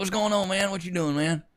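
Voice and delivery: high, casual imitation of the dad greeting his son; dad impression. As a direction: talk like his dad, pitched well up. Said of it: "This is what my dad sound, dad sounds like".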